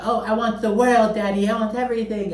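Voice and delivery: put-on high, bratty spoiled-child whine; Veruca Salt impression. [name] mocking Lisa, doing a high bratty Veruca Salt. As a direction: whine it like a spoiled rich brat. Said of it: "mimics her, like, the".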